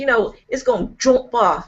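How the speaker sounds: a lightly voiced Philly girl, jump as shomp; Philly accent. Imitating Melina from Delaware, light Philly accent. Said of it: "'cause she goes".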